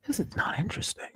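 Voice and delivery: breathy whispered aside; conspiratorial whispered jab. Real, not acted. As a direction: drop to a whisper for the verdict.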